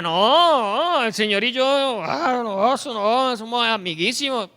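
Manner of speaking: a loud mock-proud brag; smug denial. Preacher mocking a proud believer, smug boast. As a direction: wave it off like God is your pal. Proud man claims friendship with God.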